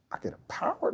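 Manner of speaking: awed hushed whisper; whispered to himself, not quite believing. Retold or imitated. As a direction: breathe it out in disbelief. Retold awed reaction to play call.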